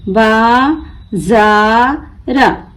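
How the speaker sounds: बाजार very slow in three pieces, बा, जा, र; broken into syllables. Shown as a demonstration. Teacher breaking the word for learners, बा... जा... र apart. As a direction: stretch and separate each part of बाजार. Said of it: "तोड़ तोड़ कर पढ़ना सीखें".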